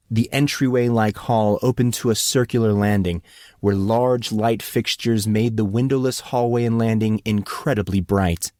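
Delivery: ordinary delivery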